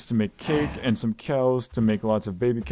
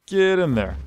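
higher, louder repeated order to the cows; louder herding shout; 8 semitones higher, 4 dB louder than usual. Real, not acted. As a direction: shove it out at the stubborn cow.

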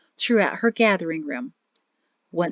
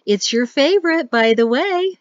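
higher, lilting tease; sing-song; 5 semitones higher, 4 dB louder than usual. Acted. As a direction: sing it up and down at him. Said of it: "she sing-songed at him".

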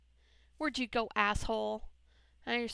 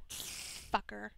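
ordinary talk, nothing marked

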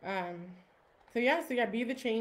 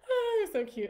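high, tearful cry; a real catch of tears, much higher; getting emotional; 12 semitones higher, 4 dB louder, slower than usual. Real, not acted. Host tearing up over the grandma picture, emotional. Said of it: "like, getting emotional".

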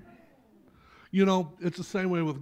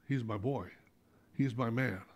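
tender God voice; 5 semitones lower, 6 dB quieter than usual. Pretend. God speaking of Job, lower and softer with fondness. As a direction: lower and softer, fond and proud. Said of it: "God says".

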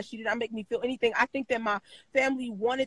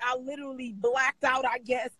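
ordinary delivery

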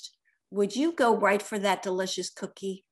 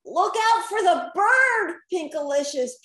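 loud, higher warning yell; hollered; 6 semitones higher, 5 dB louder than usual. A narrator's reading. Peter warning Pinkalicious of a bird, hollering. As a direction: shout a warning across the sky. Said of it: "Peter hollered".

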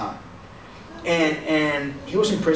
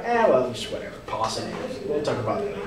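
low, fading brush-off; acted "whatever" shrug; mock dismissiveness; 6 semitones lower, 5 dB quieter, much faster than usual. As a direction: toss it away, sinking softer as you go. Mimics someone brushing the matter off.

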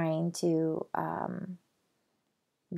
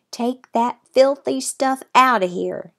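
put-on higher, louder shout in a Texas cowboy accent; 7 semitones higher, 10 dB louder than usual. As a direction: bark it out like an angry Texas cowboy. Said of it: "he suddenly shouted in his strong Texas accent".

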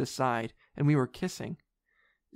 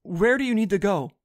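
higher, louder urgent asking; alarmed question; 6 semitones higher, 6 dB louder than usual. A narrator's reading. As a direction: ask urgently over the screaming. Alarmed friend answers screaming girl.

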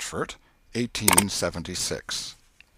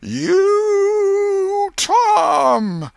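very high, loud drawn-out holler; long-distance shout; 8 dB louder than usual. A narrator's reading. Aunt shouting across the garden for the boy, long shout. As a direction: shout it far across the garden, stretching each word. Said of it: "and shouted".

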